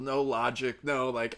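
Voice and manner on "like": normal talking voice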